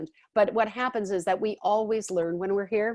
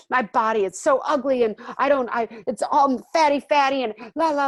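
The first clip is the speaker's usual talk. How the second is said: higher, louder mock whine about her own body; whiny self-judging; 4 semitones higher, 7 dB louder than usual. Someone judging her body, in a loud mock whine. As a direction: pile on the self-disgust, hammed-up. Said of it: "Let's say you judge your body".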